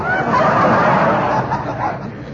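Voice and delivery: high breathy cackling laugh; gleeful cackle. Acted. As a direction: cackle with glee at the gossip. Gleeful at the engagement news.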